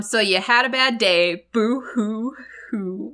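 mocking boo hoo, slow and drawn out; sarcastic fake sobbing; fake crying. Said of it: "it's like".

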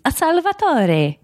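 Salvatore said sal-va-TOR-e with a rolled Italian flourish; overly fancy wrong pronunciation. Shown as a demonstration. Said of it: "names that I'm gonna try to overpronounce".

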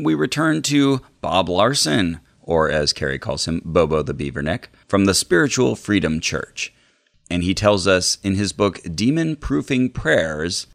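normal talking voice

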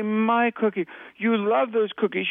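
put-on high-pitched, emphatic toddler-speak; baby talk. Shown as a demonstration. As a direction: echo a toddler's demand in a high voice. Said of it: "does it feel like baby talk?".

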